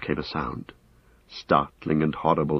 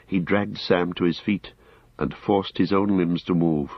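ordinary delivery, nothing marked

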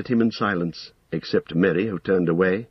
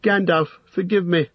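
high, distressed begging; pleading cry; 9 semitones higher than usual. A narrator's reading. Frightened hobbit begging the wizard's pardon, pleading cry. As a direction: beg the wizard in a raised pitch. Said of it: "he cried".

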